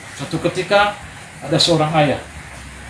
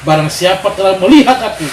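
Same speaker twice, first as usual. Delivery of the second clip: raised, louder reproachful Jesus; mild reproach; 4 semitones higher, 6 dB louder than usual. Acted. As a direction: scold Philip with disappointment. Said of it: "seperti marah dia sama Filipus". (teguran Yesus yang agak marah)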